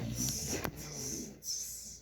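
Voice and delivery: short unvoiced teasing tss, tss, tss; mock the peddler like a cheeky monkey; monkey tss sounds.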